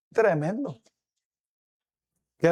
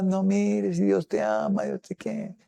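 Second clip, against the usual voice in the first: 1 sugary reassurance; 3 semitones higher, 4 dB quieter than usual. Retold or imitated. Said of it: "como compañitos".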